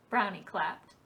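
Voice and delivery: usual speaking voice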